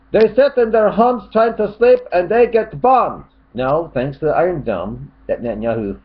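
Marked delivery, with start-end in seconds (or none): 0.1-3.2 high, loud put-on outrage; mock angry shout. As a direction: rant it high as the angry commenter. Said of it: "he starts getting really angry here".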